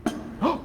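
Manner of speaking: sharp breathy story gasp; hushed dramatic intake of breath. Acted. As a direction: gasp to build suspense for the kids. Dramatic pause before the knock.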